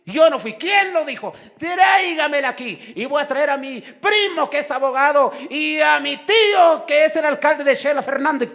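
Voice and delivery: high, loud, hammed-up protest; indignant outburst. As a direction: shout back as the wrongly accused, full of threats. Acted quarrel of accused woman. (protesta indignada a gritos, actuada)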